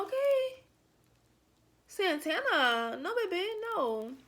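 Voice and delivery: high then drawn-out Santana; mocking correction. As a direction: slowly savour the wrong name. Mocking the wrong name.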